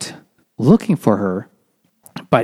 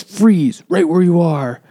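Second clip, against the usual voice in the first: louder, higher order to freeze; commanding shout; 6 semitones higher, 4 dB louder than usual. Retold or imitated. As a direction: bark a gunpoint command. Hero orders the traffickers at gunpoint.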